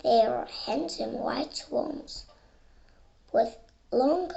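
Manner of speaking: ordinary talk, nothing marked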